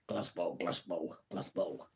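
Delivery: low, soft, a mild try at Tommy Cooper's mumble; just a hint of Tommy Cooper; bad Tommy Cooper. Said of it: "my worst impression of Tommy Cooper".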